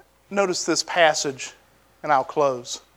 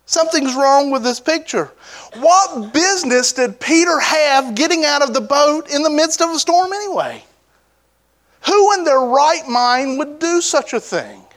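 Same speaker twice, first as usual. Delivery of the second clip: higher, puzzled questioning tone; incredulous inner voice; 8 semitones higher than usual. Retold or imitated. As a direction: ask it as if something doesn't add up. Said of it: "in my sick mind, I'm going".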